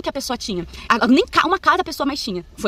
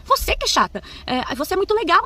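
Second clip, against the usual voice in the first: retorting; 5 semitones higher than usual. Retold or imitated. Retort in a quarrel.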